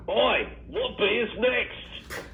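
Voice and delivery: put-on host asking for the next beer in a joking New Zealand accent. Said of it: "Is that a New Zealand accent or Australian".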